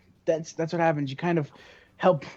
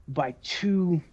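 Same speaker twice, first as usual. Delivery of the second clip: ordinary talk, nothing marked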